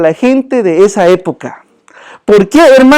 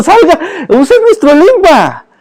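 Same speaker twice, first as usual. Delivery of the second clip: laughing, higher and slower; real amused push; 8 semitones higher than usual. Real, not acted. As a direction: chuckle while urging them on. Laughing urging to drop old speech.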